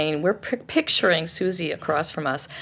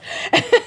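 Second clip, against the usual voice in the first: high laugh; 3 dB louder than usual. Real, not acted. Laughing while flinging socks.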